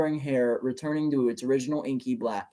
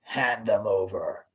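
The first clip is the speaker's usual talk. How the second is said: menacing villain; 6 semitones lower, 4 dB quieter, slower than usual. A narrator's reading. Villain demands the babies.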